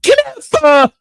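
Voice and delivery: very high mock call of the name; mocking squeal. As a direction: squeak it like her. Mocking her call again.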